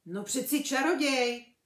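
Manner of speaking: big booming giant, loud and rough-hearted; hearty half-giant, loud and emphatic; Hagrid's voice. A narrator's reading. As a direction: speak as a huge, hearty gamekeeper. Hagrid answers Harry.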